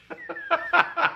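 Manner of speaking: high wheezy chuckle; real amused laughter. Real, not acted. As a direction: laugh at your own story. Laughs at his own quote.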